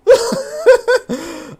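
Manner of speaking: a high-pitched burst of laughter. Real, not acted. Laughing at his own shut up, high squeal.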